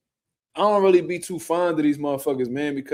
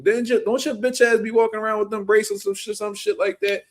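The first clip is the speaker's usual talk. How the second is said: ordinary talk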